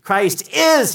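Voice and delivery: "is" thrown high and loud; emphatic stress on IS. Acted. John declaring who Christ is, emphatic "is". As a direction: proclaim it, hammering "is". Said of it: "John is saying is emphatic".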